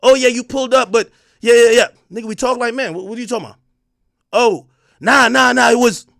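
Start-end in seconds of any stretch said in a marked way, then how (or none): 0.0-1.9 mocking rivals. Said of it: "they got all the things to say". 4.3-6.1 mocking rivals. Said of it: "they got all the things to say".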